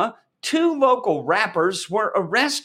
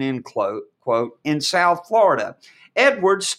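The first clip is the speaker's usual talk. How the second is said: ordinary delivery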